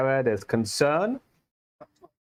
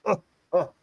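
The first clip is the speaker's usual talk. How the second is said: higher, groaning and queasy; hungover groan; 6 semitones higher than usual. Acted. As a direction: groan it out, sick from drink. Hungover NPC groans after vomiting.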